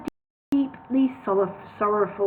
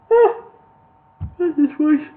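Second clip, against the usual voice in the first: usual speaking voice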